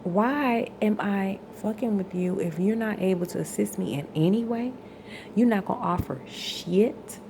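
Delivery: loud, pressing, accusing; angry rant. Real, not acted. Still ranting at her partner about his refusal, angry and loud. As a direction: demand an answer from him.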